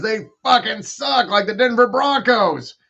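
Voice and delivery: loud, high-pitched jeer; exasperated roast. Real, not acted. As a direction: shout the insult at the losing team. Roasting a losing football team.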